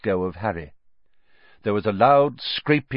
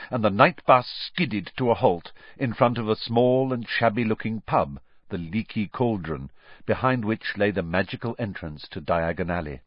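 ordinary delivery

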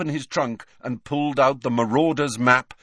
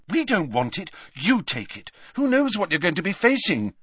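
higher, urgent protest; worried Hermione; 9 semitones higher than usual. A narrator's reading. Girl refusing potion for endangered friend, worried protest. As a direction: insist he keep it for himself.

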